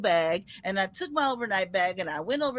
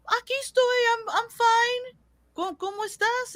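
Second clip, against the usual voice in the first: grandma's voice. Retold or imitated. Voicing grandma on the phone.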